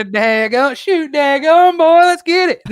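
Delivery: high, drawn-out country holler; country accent. Shown as a demonstration. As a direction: build up into a high Southern whoop. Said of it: "I have a country accent".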